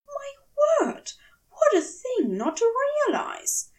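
high, near-tearful dismay; horrified at his own forgetting; distressed angel voice. Acted. Aziraphale's horrified realization.

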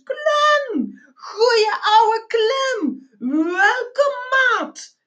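very high, slow drawn-out holler; bellowing back. A narrator's reading. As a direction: roar a welcome to your old mate. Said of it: "de andere brulde op zijn beurt". (hoog en langgerekt terugbrullen)

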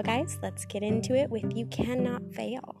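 usual speaking voice

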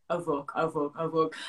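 low, soft muttered oh fuck; dread. Retold or imitated. Checking her phone, a soft low oh fuck. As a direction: panic creeping in under the breath. Realising news about her.

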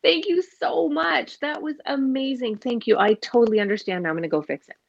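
gushing, high-pitched exaggerated thanks; mock grateful child. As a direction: play a child absurdly thankful for being yelled at. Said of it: "they've gone".

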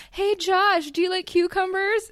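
chirpy host offering food; cheerful friendly voice. Retold or imitated. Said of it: "and he's like".

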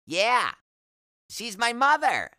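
put-on high, loud, eager kid; young boy's voice. A narrator's reading. Boy telling the patient about his mother, high loud kid voice. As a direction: pipe up high like a little boy.

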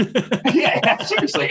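high laughing yeah, yeah; laughing agreement. Real, not acted. Laughing along with host.

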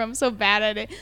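normal talking voice

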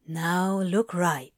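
low gravelly hush, slow. A narrator's reading. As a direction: keep it hushed and gravelly. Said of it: "still speaking in that gravelly, hushed tone".

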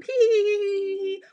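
high mocking hee-hee giggle. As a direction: giggle like a gossipy boy. Mock giggling gossip guy.

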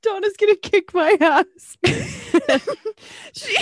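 real high-pitched cracking-up, words squeezed out; helpless laughing. Real, not acted. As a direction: barely get the words out through laughter. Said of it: "I've never laughed so hard".

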